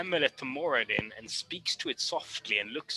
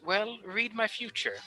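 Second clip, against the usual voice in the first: higher, softer amused challenge; amused fortune teller; 7 semitones higher, 5 dB quieter than usual. Acted. Fortune teller teasing the party, amused. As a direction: lean back and dare her to read it. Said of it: "She looks amused as she goes".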